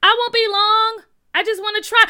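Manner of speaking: high, loud shout to friends; calling back. Acted. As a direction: holler back from out on the ice. Boy calls back from the ice.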